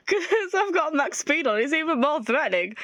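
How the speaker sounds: amused aside, chuckling; real giggle; laughing. Real, not acted. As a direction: laugh through the explanation.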